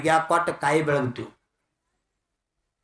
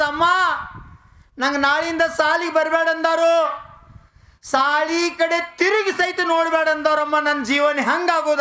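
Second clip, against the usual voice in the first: high, acted wail to mother; preacher's hammed-up child lament; imitating tearful child; 11 semitones higher, 4 dB louder than usual. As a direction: plead as a crying child, pitched way up. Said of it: "ಅಂತ ಮಗು ಅಳಾಕತ್ತದ".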